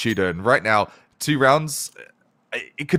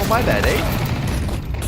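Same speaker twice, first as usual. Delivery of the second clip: slow, higher mock Canadian "my bad"; Canadian apology; 7 semitones higher, much slower than usual. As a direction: drag out a sheepish Canadian sorry. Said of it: "Typical Canadian fashion".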